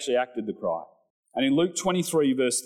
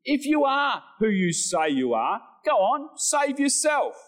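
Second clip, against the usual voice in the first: preacher voicing the mockers; mocking; 10 semitones higher than usual. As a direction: goad him like a bully. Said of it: "they're mocking him".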